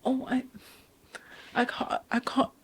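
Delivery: very soft, faltering retold excuse; timid stammer. Worker frozen before the auntie, timid and barely audible. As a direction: shrink into a tiny hesitant near-whisper. Retelling her scared, frozen reply.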